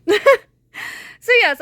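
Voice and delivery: slow and loud, breaking into laughter; embarrassed laugh mid-sentence. Real, not acted. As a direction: laugh through the words at yourself. Laughing at her own mistake.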